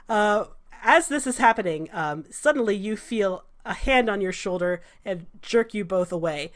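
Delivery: normal talking voice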